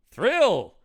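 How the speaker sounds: high, loud mock thrill; hammed-up thrill. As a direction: overact the word Thrill. Two takes, second hammed up.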